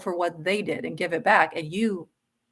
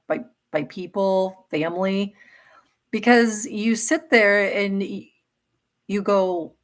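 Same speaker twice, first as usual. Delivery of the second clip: ordinary delivery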